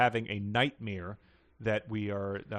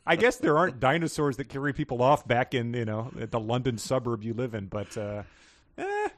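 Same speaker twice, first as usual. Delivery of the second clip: usual speaking voice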